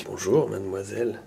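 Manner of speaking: low, soft, husky seductive greeting; husky charming voice. Pretend. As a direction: purr it close and husky to charm. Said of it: "Ça marche qu'à proximité".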